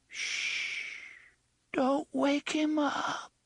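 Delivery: put-on frightened child whisper. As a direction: hush him like a scared little child. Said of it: "the child's voice mourned".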